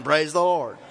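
ordinary delivery

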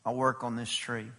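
soft, low, gentle plea; quiet pleading. Acted. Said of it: "The dresser of the vineyard said".

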